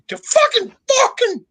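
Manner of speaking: high-pitched sputtering rage; hammed-up, high indignant outburst; mock fury. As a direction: act out losing it, voice shot way up. Acted outburst in church story.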